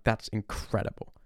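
hushed awed whisper. Retold or imitated. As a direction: whisper it in amazement. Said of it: "I'm like".

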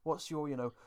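ordinary talk, nothing marked

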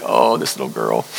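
put-on low, tender aside about a crying teen; tender pity. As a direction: sigh it out gently, moved by her tears. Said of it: "And I'm like".

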